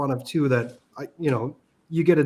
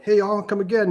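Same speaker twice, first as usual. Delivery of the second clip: southern greeting; 7 semitones higher than usual. Retold or imitated. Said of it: "or like".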